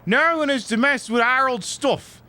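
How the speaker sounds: high British gangster voice; British game thug. Acted. Thug warning nobody to mess with stuff, high British voice. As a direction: pitch up a mock British thug's order.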